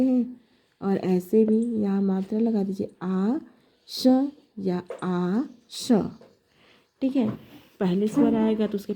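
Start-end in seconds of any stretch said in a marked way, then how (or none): 3.0-6.0 slow sounding out. Shown as a demonstration. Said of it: "teacher sounding out letters".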